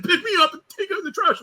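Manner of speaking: put-on high, loud drunk demand; drunk fan. Drunk fan demanding the finishing move, high pitched. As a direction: push the request like a drunk. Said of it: "He's like".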